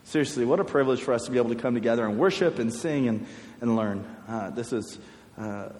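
a soft, hushed prayer voice; a youth pastor praying quietly for his students. Acted. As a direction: pray quietly over the students. Leads the group in prayer.

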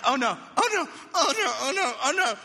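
high, loud repeated oh no; giddy panic. Retold or imitated. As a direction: mimic her flustered panic. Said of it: "she's like".

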